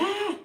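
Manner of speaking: short high-pitched mock cry of frustration; high, exasperated exclamation. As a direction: let out a retold exasperated yelp. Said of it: "supposed to be nice and calm".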